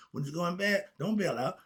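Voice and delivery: usual speaking voice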